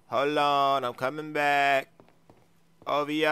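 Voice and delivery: slow, drawn-out singsong speech. Acted. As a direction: stretch the words as if singing. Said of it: "sounds like he's singing or something".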